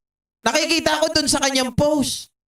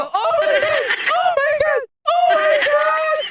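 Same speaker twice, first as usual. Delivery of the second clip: mock ecstatic screaming; 14 semitones higher, slower than usual. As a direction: shriek it like a mocked moment of pleasure, laughing through it. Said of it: "Parang sumisigaw". (pabirong malakas na sigaw)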